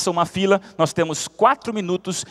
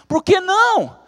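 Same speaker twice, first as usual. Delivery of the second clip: exasperated question; 14 semitones higher, 6 dB louder, slower than usual. Acted.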